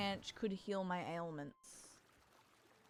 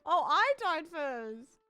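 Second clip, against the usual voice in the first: dramatic; 7 semitones higher, 7 dB louder, slower than usual. Real, not acted. Dramatic reaction to dying.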